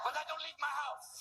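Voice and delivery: high, manic put-on threat; offended French meth addict. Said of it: "we've offended French meth addicts".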